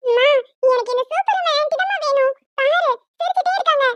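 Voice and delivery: put-on high, eager kid's plea; pleading child. Little girl begging her mother for the aunt. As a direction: beg mum like a small girl.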